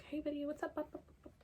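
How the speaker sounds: mock flirty greeting. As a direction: lightly play a guy calling his crush. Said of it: "be like".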